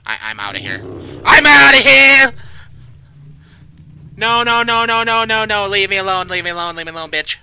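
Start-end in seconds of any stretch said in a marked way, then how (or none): 1.3-2.3 very high, very loud, drawn-out yell; panicked scream. Real, not acted. Player fleeing after a bad feeling, panicked scream. 4.2-7.4 high, loud rapid repetitions, gradually easing; panicked shouting. Real, not acted. Player yells at pursuer to leave, panicked shout. Fleeing, pleading to be left.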